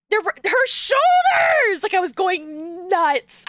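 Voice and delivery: real exasperated outburst; yelling in disbelief at the costume; outraged shout. Real, not acted. Ranting about bare shoulders.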